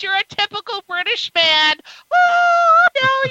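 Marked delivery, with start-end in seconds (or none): 2.1-3.3 teasing squeal. Real, not acted. Teasing guest.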